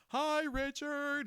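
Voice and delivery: high, drawn-out loud greeting; calling from afar. Retold or imitated. Acting coworker greeting him from afar, stretched call. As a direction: imitate a long-distance holler. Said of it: "like, from afar".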